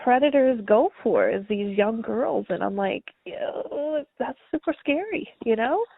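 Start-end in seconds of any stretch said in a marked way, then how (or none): 3.2-5.3 breathy, hushed mock fright; hushed, spooked whisper over a creepy thought; scared whisper. Retelling own scared reaction.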